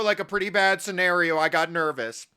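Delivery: ordinary delivery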